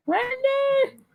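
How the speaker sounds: very high imitated holler of a name; comic high-pitched name call; mock Southern yell. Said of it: "I love when she yells his name".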